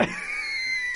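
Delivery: high-pitched burst of laughter; a squealing laugh that breaks out; cracking up mid-story; shrieking laugh. Real, not acted.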